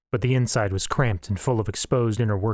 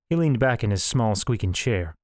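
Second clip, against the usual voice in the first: plain reading voice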